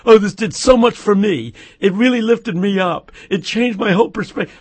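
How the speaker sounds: mock gushing, high-pitched and loud; tearful gushing. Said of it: "come back with tears running down their cheeks".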